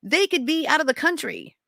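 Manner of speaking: high-pitched, indignant disbelief; incredulous. Retold or imitated. Mother of a missing boy scoffing at police, incredulous. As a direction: protest as if it's absurd.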